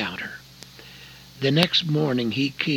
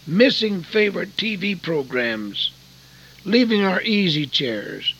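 impassioned preaching; 5 semitones higher, 5 dB louder than usual. Acted. Preacher exhorting the congregation.

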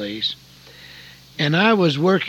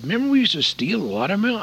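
eager reminiscing; 8 semitones higher, faster than usual. Retold or imitated. Darrell recalling boyhood mischief.

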